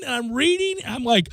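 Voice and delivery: ordinary talk, nothing marked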